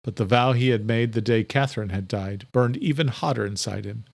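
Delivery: ordinary delivery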